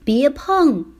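high, urgent shouted warning; loud warning shout. Acted. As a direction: shout to stop a child. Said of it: "大声喊起来".

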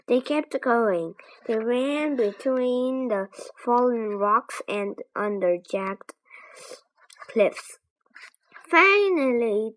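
ordinary delivery

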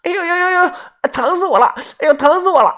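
ouch-ouch pained howl; cries of pain. Acted. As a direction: wail like you just hit the ground. Fallen girl crying out in pain.

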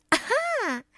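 high delighted "aha"; gleeful elf. Acted. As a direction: pounce on it with glee. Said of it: "小精灵笑了笑". (得意的小精灵笑声)

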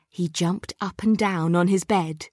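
plain reading voice

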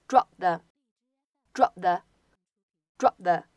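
"drop the" with the p unreleased; "drop the" with the p closed but not popped; incomplete plosion. Shown as a demonstration. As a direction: hold the p of "drop" and glide into "the". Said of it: "也有一个不完全爆破".

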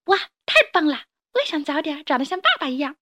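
put-on a kid amazed at dad; excited child. 小恐龙赞叹. (小孩子兴奋地赞叹)

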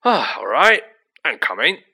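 loud, grudging giving-in; big gruff giant, put out; gruff impatience. Acted. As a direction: call out gruffly as you leave.

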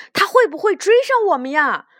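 high, loud, quick anxious question; nervous. A narrator's reading. Rabbit fleeing a badger asks friends, nervous. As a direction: ask in a fluster while being chased. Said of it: "咖啡豆紧张地问".

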